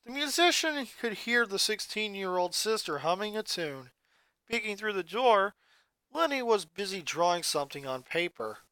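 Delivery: ordinary delivery, nothing marked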